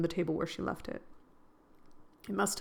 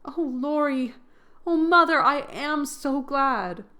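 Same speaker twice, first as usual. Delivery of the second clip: higher and much louder, drawn out with joy; joyful cry; 7 semitones higher, 7 dB louder, slower than usual. A narrator's reading. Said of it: "crying out with a joyful cry".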